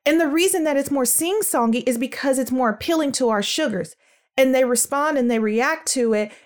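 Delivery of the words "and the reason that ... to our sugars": ordinary talk, nothing marked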